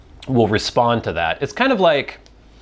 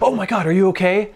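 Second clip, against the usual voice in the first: alarmed; 8 semitones higher than usual. Retold or imitated. Panicked reaction to hurt brother.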